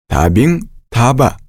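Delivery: ordinary talk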